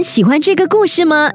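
ordinary delivery, nothing marked

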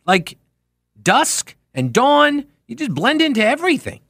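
high-pitched, baffled pleading; exasperated rant. Real, not acted. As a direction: plead in disbelief, pitch riding high on each phrase. Rant at gray-car drivers without headlights.

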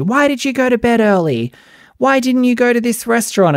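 squeaky hammed-up housewife accusation; mocking petty housewife. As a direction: parody a bickering reality star's fake grievance.

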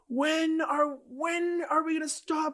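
high, slow ranting protester; megaphone protester impression. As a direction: mimic a guy on a megaphone. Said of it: "He goes".

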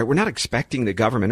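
ordinary talk

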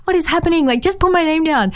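high-pitched, incredulous "What?"; exasperated disbelief. Retold or imitated. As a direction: squeak out polite outrage. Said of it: "concerned about being nice".

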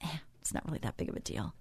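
quick, hushed brush-off; mock-casual whispered shrug; dismissive whisper. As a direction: whisper it like an excuse to yourself. Voicing dismissive inner thought.

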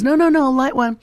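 high, loud mock insistence; insistent grandpa. Grandpa insists child light cigarette.

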